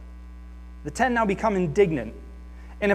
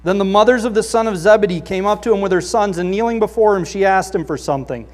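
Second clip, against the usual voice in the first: loud, raised scripture reading; 2 semitones higher, 6 dB louder than usual. Acted. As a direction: proclaim the passage to the congregation. Reads scripture aloud.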